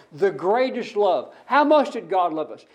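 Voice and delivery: usual speaking voice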